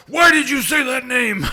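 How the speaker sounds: loud, high hammed-up outburst; over-the-top angry shout; mock furious demand. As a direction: roar it like an outraged movie hero. Acting Batman's furious reaction.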